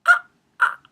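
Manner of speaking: short, very high, loud yelps; starstruck squeals. Retold or imitated. Fan offered an introduction to her idols, squealing. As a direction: squeal in little high bursts.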